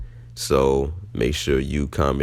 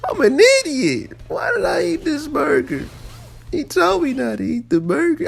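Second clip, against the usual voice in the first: mock crying plea. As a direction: wail it like a begging, crying kid. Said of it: "I was just pleading on the toilet".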